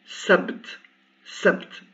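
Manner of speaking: sabt with no vowel after b, final t clipped; sukun demo. Shown as a demonstration. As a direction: say sabt with b and t bare. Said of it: "je prononce". (sabt sans voyelle, consonnes nues)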